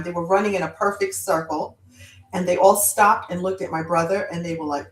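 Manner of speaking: ordinary delivery, nothing marked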